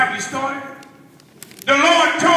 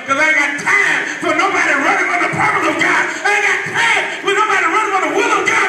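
usual speaking voice

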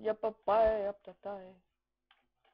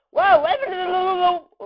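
high, loud, startled protest; alarmed outburst; 7 semitones higher, 13 dB louder, much faster than usual. Real, not acted. Gamer caught off guard mid-sentence, alarmed outburst. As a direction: blurt it out loudly in alarm. Sudden danger in the game.

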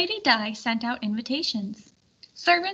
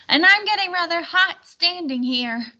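complaining; 7 semitones higher, 4 dB louder than usual. Acted. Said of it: "complained Sir Tangent".

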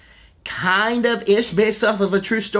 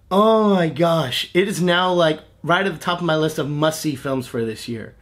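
usual speaking voice

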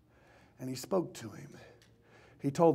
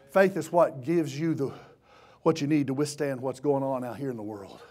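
normal talking voice

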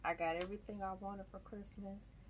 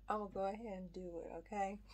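ordinary talk, nothing marked

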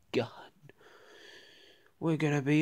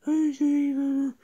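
high, quick, squeaky voice; squeaky retort; 10 semitones higher, faster than usual. Acted. Other toy mocking rival seatmate, squeaky high retort. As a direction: snap it back in a tiny high voice. Second toy echoes the insult.

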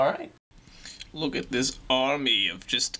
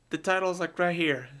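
usual speaking voice

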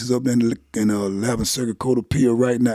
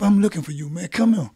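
higher-pitched retold summons; calling him over; 8 semitones higher, 3 dB louder than usual. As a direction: imitate someone calling a man over. Retold woman calls him over.

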